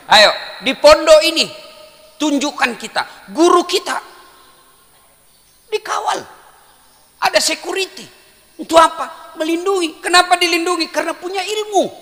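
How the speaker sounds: high, loud rallying sermon; fiery call-and-response preaching; preaching at full force. Acted. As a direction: rouse the students with questions.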